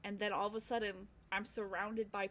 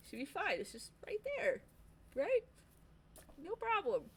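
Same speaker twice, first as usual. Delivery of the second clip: high-pitched, talking yourself into it; self-reassurance; 9 semitones higher than usual. Retold or imitated. Reassuring herself before risky walk.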